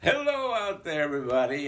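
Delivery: high, loud call to the crowd; booming greeting. Acted. Santa greeting a whole school, loud and high. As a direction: call out hello to everyone far away.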